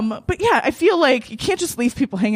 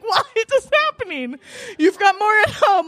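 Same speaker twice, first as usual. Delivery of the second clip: retold dismay; 9 semitones higher than usual. Woman reacting to her date's calm reply, dismayed. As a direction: wail it in exaggerated bewilderment.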